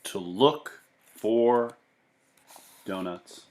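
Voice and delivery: lively read-aloud voice for a class; storybook narration. A narrator's reading. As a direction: read it to the kids, with bounce and wonder. Teacher reads picture book aloud.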